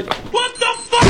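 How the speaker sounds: very high, startled shout; shouted outburst. Acted. Comedian reacts mid-story, high startled shout. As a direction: burst out high and incredulous. Sudden outburst while waiting on hold.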